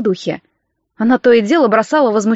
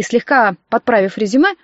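ordinary delivery, nothing marked

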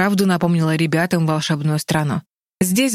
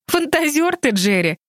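laughing; 10 semitones higher than usual. A narrator's reading. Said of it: "рассмеялась Энни".

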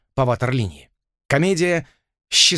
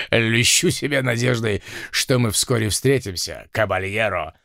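a low, polished Spanish grandee; admiral voice; 3 semitones lower than usual. A narrator's reading. Admiral's farewell reply.

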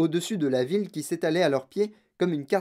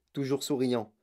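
plain reading voice

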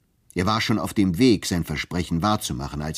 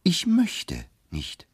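slow, higher, final refusal; a slow, flat-out refusal to the boss; stubborn refusal; 11 semitones higher, much slower than usual. Acted. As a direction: drag each word out, unbending. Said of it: "Sie wollen nicht?".

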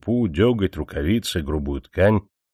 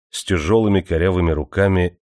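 plain reading voice

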